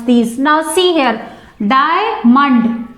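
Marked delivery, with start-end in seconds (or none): 1.7-2.9 Diamond broken in two, Dia-mond; split into syllables. Shown as a demonstration. Teacher showing students two syllables, Diamond split as Dia-mond. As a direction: say Diamond in two separate beats. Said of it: "two syllables".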